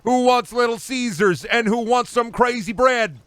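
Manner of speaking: a boss shrieking about pizza; manic boss. Pretend. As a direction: squeal it like an unhinged cheerful boss.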